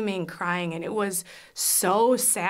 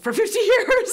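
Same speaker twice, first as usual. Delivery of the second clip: louder, high-pitched, breaking into laughter; laughing exclamation; 8 dB louder than usual. Real, not acted. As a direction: let the last words burst into a laugh.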